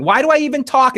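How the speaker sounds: high, exasperated yell; ex-wife yelling in frustration; retold yell. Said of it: "who's yelling".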